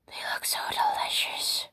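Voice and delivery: a breathless whisper to herself. Acted. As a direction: whisper it like a guilty secret. Craving cookies, hushed aside.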